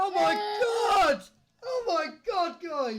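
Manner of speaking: high disbelieving cry; can't believe the pull; excited. Real, not acted. Reacting to pull.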